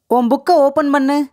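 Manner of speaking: normal talking voice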